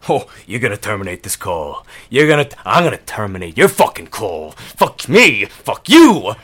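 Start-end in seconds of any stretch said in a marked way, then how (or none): 2.1-6.3 fast, angry, swearing at the agent; furious rant. Acted. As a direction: blow up at him. Customer explodes at agent.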